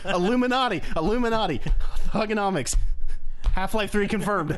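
mock hype.

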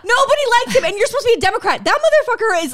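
high, loud, heated scolding; exasperated rant. Real, not acted. Scolding a politician meant to be a Democrat, exasperated rant. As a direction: tear into him at high pitch.

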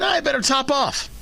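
a hint of a nervous motorist; voicing worried drivers. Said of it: "people saw this and said".

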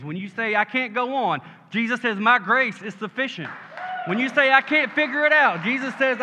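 high, driving call-and-answer sermon; full-force preaching. Real, not acted. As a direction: hammer each promise to the congregation.

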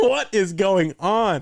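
slow, high, baffled question; mock bewildered. Recounting to his cohost his reaction to the criticism, slow and baffled. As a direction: stretch it out like you can't believe it.

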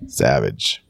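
a low, flat one-word verdict from the son; dry put-down, dropped low; deadpan teen reply. Retold or imitated. Teenage son texts back, dry low deadpan. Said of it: "then he responds with".

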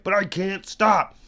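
high, drawn-out retold wail of protest; slow, high-pitched helpless protest; mock desperate protest. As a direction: plead helplessly, hammed-up. Acts out his protest to wife.

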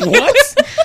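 high, squeaky incredulous what, laughing; laughing falsetto what; mock bewildered. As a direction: play a baffled listener hearing the name. Mock confused listener.

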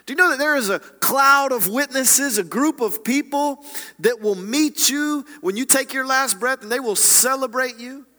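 high, forceful sermon delivery; impassioned preaching. Real, not acted. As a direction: preach it with full conviction, driving each declaration home.

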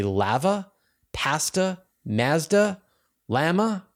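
Canadian accent: lava, pasta, Mazda, llama with a flat a as in cat. Shown as a demonstration. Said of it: "you're gonna need to come to grips with my Canadian accent".

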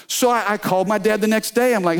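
ordinary delivery

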